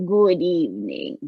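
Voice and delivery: deep-pitched, measured trance-medium voice; Silver Cloud. Acted. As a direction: greet the room as an ancient channelled spirit. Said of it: "We have Silver Cloud coming through".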